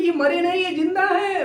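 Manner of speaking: high, tearful pleading; a wife weeping over her husband's body, pleading high-pitched; crying wife. A narrator's reading. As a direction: beg through tears that he's alive. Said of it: "रो रो के बोल रहे".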